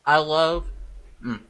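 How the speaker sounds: talking through a mouthful of pizza; words round a bite of food; mouth full. Real, not acted. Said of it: "I'm talking with my mouth full".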